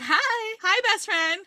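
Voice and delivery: high, eager puppy greeting; happy dog voice. Pretend. The dog greets Sloane in the car, high and giddy. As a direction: squeal it like a giddy dog meeting its new owner.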